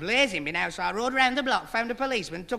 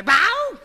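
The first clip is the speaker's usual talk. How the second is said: sudden high loud yelp; yelp of pain; 12 semitones higher, 9 dB louder than usual. Acted. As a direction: cry out as the nib jabs your fingers. Pen nib stuck in fingers.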